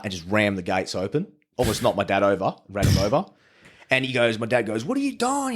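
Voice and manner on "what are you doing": startled dad's question. Retold or imitated. Dad confronts the owner.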